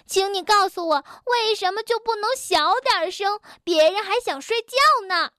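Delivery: 高声喊着抱怨; 被吵醒冲着对方喊. Acted. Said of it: "大喊道". (蹦蹦被吵醒高声抱怨)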